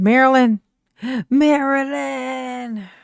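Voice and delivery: calling out. Pretend. Streamer summoning a friend to the live, calling out. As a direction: holler the name and stretch it.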